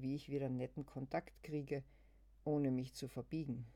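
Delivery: usual speaking voice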